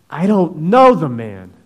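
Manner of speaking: loud, slow oath; swearing denial. Acted. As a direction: deny it with an oath, slowly and loud. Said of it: "began to call down curses".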